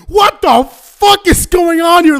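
confused shout. Retold or imitated. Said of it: "Russians are just like".

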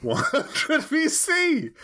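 high, slow, laughing; laughing repeat. Real, not acted. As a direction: laugh it out, drawn out.

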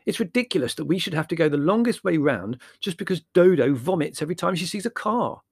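high, loud indignant rant; outraged complaint; exasperated. Acted. Larry resumes his exasperated protest.

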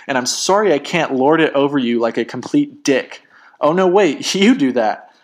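heated mock apologies, building in exasperation; mocking, emphatic comeback; sarcastic apology. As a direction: spit out each sorry with heavy sarcasm.

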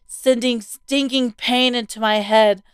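ordinary delivery

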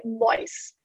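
"nice" said as "noice", Australian-style. Shown as a demonstration. Guest showing host how Australians say it, Australian accent. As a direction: say "nice" with an OY vowel, low-key Australian. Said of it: "I would say".

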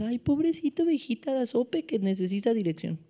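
high, cooing poor-little-thing voice; mock pity. Pitying a helpless little sheep.

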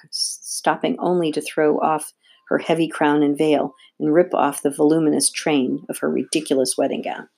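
ordinary delivery

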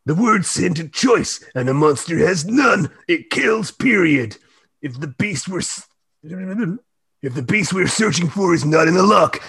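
a grim hunter giving a warning; stern monster hunter. Acted. Reads Mandanauer's lines, gruff and loud.

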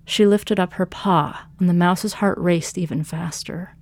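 usual speaking voice

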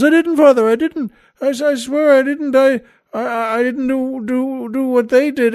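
high, stammering frantic denial; pleading altar boy. Acted. Third altar boy denies stealing to the priest, frantic pleading. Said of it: "And the boy says".

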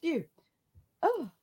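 breathy after a sneeze; catching breath after sneeze; sneezing. Real, not acted. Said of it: "I'm gonna sneeze again".

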